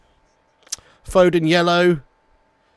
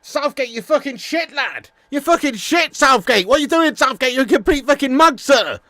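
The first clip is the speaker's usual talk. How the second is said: furious yelling; 8 semitones higher, 3 dB louder than usual. Pretend. Mock phone call abusing Southgate.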